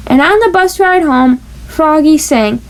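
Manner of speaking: usual speaking voice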